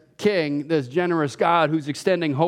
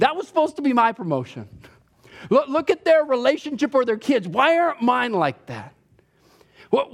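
higher, aggrieved pleading; whiny complaint to God; 7 semitones higher than usual. Pretend. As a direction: complain to God like a jealous child.

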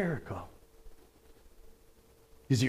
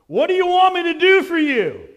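voicing Jesus; 11 semitones higher, 6 dB louder, faster than usual. Jesus voiced in story.

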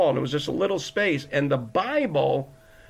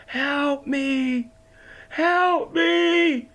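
retold desperate scream; 11 semitones higher, much slower than usual. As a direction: scream for help from the bottom of the floor. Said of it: "literally screaming from the top of my lungs".

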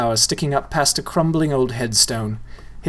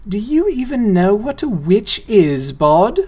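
put-on girl's voice; 8 semitones higher, 4 dB louder, slower than usual. Girl voiced in story.

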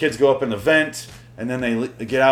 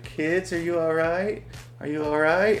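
lightly imitated mom calling out to kids, drawn out; higher, stretched call with a questioning rise; mother calling; 6 semitones higher, 3 dB quieter, slower than usual. Mother calls to kids hiding behind the door, mock calling out.

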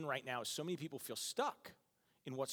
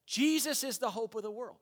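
preaching at full force; 7 semitones higher, 5 dB louder than usual. Real, not acted. Preaching climax.

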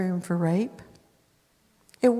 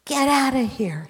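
snarled; 6 semitones higher, 4 dB louder than usual. Acted. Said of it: "he snarled at her".

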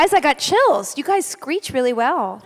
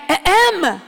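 high, pointed "ahem"; imitated baby cough; 5 semitones higher, 3 dB louder than usual. Mother imitates her baby at night, attention-getting cough. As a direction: clear the throat like a demanding baby. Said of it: "She's literally coughs".

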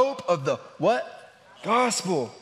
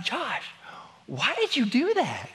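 sweet mom; 7 semitones higher than usual. Retold or imitated. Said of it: "she's just sweet".

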